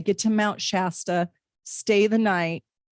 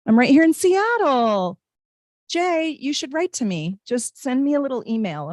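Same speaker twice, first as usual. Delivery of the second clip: bright, higher, eager invitation; excited; 6 semitones higher, 4 dB louder than usual. Real, not acted. Streamer thrilled a viewer is local, excitedly higher. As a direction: gush at a viewer from your own city.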